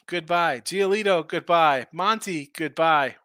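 ordinary talk

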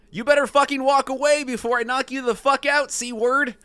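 high, heated threat; acted angry threat. A narrator's reading. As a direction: read it as a fired-up warning. Acted threat in golf quarrel.

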